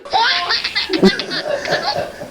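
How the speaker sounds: a high, airy laugh after the punchline; high unvoiced giggle; high breathy laugh. Real, not acted. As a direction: break into a wheezy giggle.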